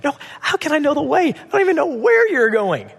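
high-pitched, incredulous protest; baffled Thomas. Acted. Thomas answering Jesus about the way, high baffled protest. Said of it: "And Thomas is like".

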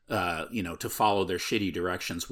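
ordinary talk

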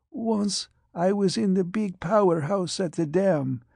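frail patient's breathy whisper; hushed whisper. Acted. Patient recalls the powerhouse to the doctor, whispering. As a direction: whisper it as the memory comes back.

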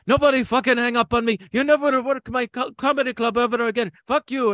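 put-on high, loud shouting in a thick Lebanese accent; furious Lebanese-accented yell. Owner calling back the ventriloquist, loud Lebanese-accented fury. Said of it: "Pete calls back and goes".

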